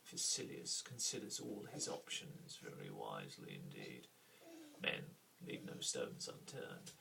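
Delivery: slow, low, secretive mystic voice; a standoffish mystic, low and hushed; mysterious standoffish character. Shown as a demonstration. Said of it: "the standoffish approach".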